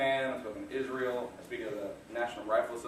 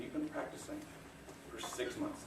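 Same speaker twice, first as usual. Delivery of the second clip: whispered thought; 9 dB quieter than usual. Retold or imitated. Panicked inner thought before stage.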